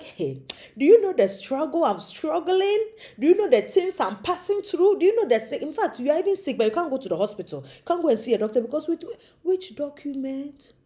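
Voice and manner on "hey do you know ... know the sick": exasperated protest. Retold or imitated. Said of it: "you're like".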